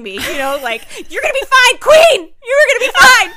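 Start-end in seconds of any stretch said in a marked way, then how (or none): 1.1-3.3 high, loud mock consolation through laughter; imitating. Naked stranger comforting a cancer patient, high laughing squeal. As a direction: squeal it while shaking her friend, laughing. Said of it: "being like, shaking".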